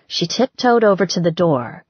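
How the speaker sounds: ordinary delivery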